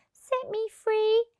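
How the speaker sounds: high, slow pleading; begging mermaid. A narrator's reading. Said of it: "And when she begged him".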